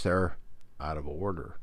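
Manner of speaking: ordinary talk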